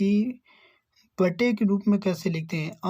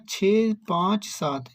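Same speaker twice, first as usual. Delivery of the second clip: slow, each digit छः पाँच सात set apart; much slower than usual. Shown as a demonstration. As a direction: read छः पाँच सात one digit at a time, slowly. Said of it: "छः पाँच सात अलग अलग लिखा है ना".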